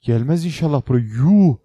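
loud, high panicky pleading; real nervous shout; scared. Real, not acted. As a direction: beg it not to come, then gasp out yuh. Monster approaching the house.

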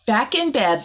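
plain reading voice

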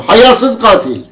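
loud, high parting insult; outraged retold yell; angry shouting. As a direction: fling it out as a reenacted shout. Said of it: "avazım çıktığı kadar bağırdım üstüne".

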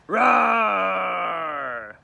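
loud, long, high monster roar falling away; bear roar. Acted. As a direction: roar like a beast at the window. Bear roars in the night.

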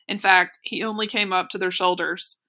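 usual speaking voice

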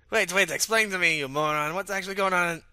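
high, loud, agitated outburst; irate demand. Acted. Caller demanding an explanation from a moron, irate. Angry demand on a phone call.

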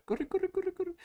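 tiny squeaky cheering voice; high squeaky voice. Pretend. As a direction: squeak it like a cartoon critter. Cheering the little beans on.

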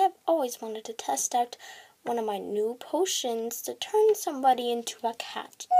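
ordinary delivery

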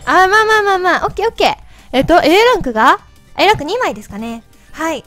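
chipmunk voice from helium. Real, not acted. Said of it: "自分で聞いてると声よくわかんない". (ヘリウムガスの甲高い声)

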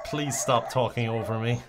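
ordinary talk, nothing marked

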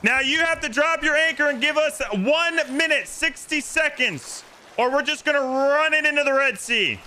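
calling out orders. Real, not acted. Player giving the rival crew a meeting place and deadline, calling out. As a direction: announce terms to a distant crew.

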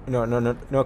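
normal talking voice

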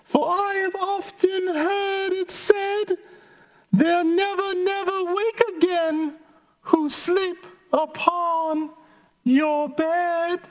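high squeaky fly voice; tiny high-pitched creature voice. Acted. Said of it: "said the little fly".

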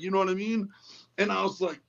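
ordinary delivery

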